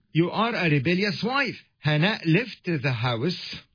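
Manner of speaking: normal talking voice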